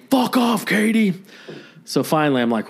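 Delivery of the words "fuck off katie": high, hammed-up furious yell; yelling neighbor. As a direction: bark it through the door at her. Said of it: "he yells through the door".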